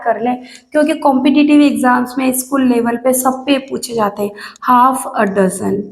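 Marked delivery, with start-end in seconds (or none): 4.7-5.8 slow dictation. Shown as a demonstration. Teacher dictates phrase slowly.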